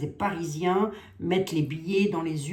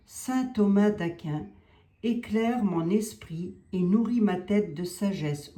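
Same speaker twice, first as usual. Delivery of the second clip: prayer; 3 semitones higher, 3 dB louder, slower than usual. Acted. Invoking a saint in prayer.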